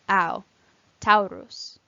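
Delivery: "au" as one ow glide, then "Taurus" with it; AU diphthong. Shown as a demonstration. Teacher modelling a diphthong, "au" in "Taurus" as ow. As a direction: say "au" and "Taurus" with an ow sound. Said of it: "are pronounced as one sound".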